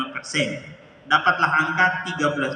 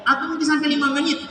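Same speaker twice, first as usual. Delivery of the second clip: emotional recollection; 8 semitones higher than usual. Real, not acted. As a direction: let the memory of weeping lift the voice. Recalling crying together for minutes. (mengenang tangisan, suara tinggi penuh haru)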